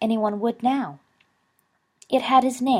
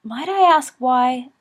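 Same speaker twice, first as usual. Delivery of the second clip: slow, careful, hesitant asking; hesitant question; 6 semitones higher, 8 dB louder, slower than usual. A narrator's reading. Father hesitantly asking Dumbledore why he came. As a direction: ease into the question warily. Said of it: "Marcellus said hesitantly".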